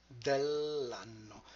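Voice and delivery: "Dell'anno" slowly, with the double n held; slow double consonant. Shown as a demonstration. Teacher slowly modelling "Dell'anno" for learners. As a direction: stretch the nn in "Dell'anno". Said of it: "We'll get to the double consonants later".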